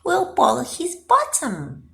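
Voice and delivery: high squeaky scheming voice; plotting in a high piggy voice; sly pigs. A narrator's reading. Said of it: "they chuckled".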